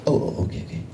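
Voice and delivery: lightly imitated Italian-accented father; dad's Italian accent. Dad startled from watching TV, in an Italian accent. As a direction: play a flustered Italian dad catching on. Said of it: "In his Italian accent".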